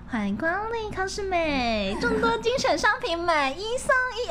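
high sing-song store clerk greeting; shop greeting call. Shown as a demonstration. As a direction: call out like a cheerful cashier. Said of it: "我超会喊的啦".

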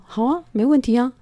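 bright, high, eager agreement; cheerful yes. Retold or imitated. Answering a friend who needs a bed, eagerly agreeing.

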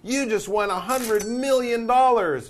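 high, loud burst of big news; excited announcement. Pretend. Teacher pretending to announce a huge win, excited and loud. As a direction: blurt it out like thrilling news.

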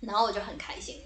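ordinary talk, nothing marked

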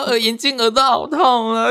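put-on child crying about sore ears. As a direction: whimper like a hurting kid. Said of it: "然后说".

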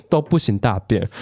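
ordinary talk, nothing marked

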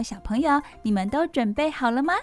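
ordinary delivery

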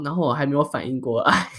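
ordinary delivery

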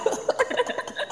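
a high, airless burst of laughter; breathy laugh. Real, not acted. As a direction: let out a quick unvoiced laugh. Laughs at forgetting Poland joke.

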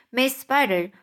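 plain reading voice

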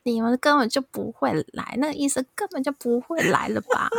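high, pleading accusation at the nurse; patient in labor accusing the nurse, furious and high; angry yell. Retold or imitated. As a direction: yell it like she's lying to you. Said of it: "很生气的大吼".

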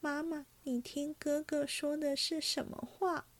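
put-on a hurt girl turning to her mother in tears; crying. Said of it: "拉着薛姨妈哭道".

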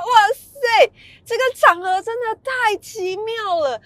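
amazed. Retold or imitated. Amazed at the strange scene.

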